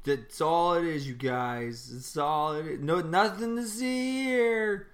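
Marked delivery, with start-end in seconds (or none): 2.8-4.9 high, slow cover-up official; hammy nothing-to-see-here voice; mock official. Co-host mocks cover-up.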